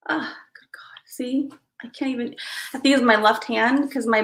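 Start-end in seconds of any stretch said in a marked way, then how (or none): 0.1-2.3 whispered aside. Real, not acted. Muttering to herself.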